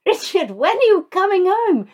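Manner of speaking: pleading for Richard to come home, overdone; mock anguished call. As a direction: call out like a jilted lover from a moving car. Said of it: "We'd go".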